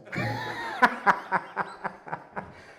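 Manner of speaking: real laughter breaking out; big laugh. Real, not acted. Laughing at croissant debate.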